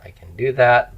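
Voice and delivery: usual speaking voice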